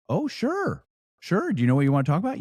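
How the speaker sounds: high-pitched, lightly acted surprised agreement; imitating worshipful master. Worshipful master answering education request, mock surprised assent. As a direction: lift the voice as the master caught off guard. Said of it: "brought it up to the worshipful master".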